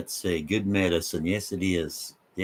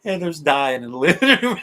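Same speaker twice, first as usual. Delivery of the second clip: laughing; 5 semitones higher, 5 dB louder than usual. Real, not acted. Laughing fit on a livestream.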